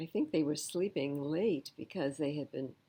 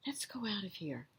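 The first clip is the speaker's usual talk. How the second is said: scared whisper; 2 semitones higher, 6 dB quieter than usual. A narrator's reading. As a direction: breathe it urgently. Fleeing in fear, whispered.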